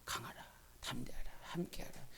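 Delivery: 숨소리로 속삭이듯; 마음속에 들려오는 말씀처럼 속삭여; whisper. Retold or imitated. 들려온 말씀을 속삭임. (속삭이듯 조용히)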